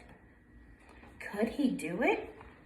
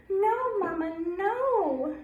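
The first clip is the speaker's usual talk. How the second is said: scared ducklings crying; 6 semitones higher, slower than usual. Acted. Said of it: "cried Waddle".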